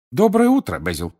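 high, loud cheery hello; bright greeting. Acted. Mr Weasley greets Basil on arrival, high hearty greeting. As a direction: call out the greeting, pitched well up.

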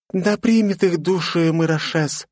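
slow, high, reverent blessing; solemn prayer. A narrator's reading. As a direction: pray over the dead. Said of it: "скрестила на груди руки девушка".

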